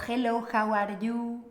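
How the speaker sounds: mock hyperarticulated Spanish-accented English; teacher mimicking Spanish speakers' English; overarticulated Spanish English. Imitating a Spanish speaker's English, mouth wide open. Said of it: "El clásico".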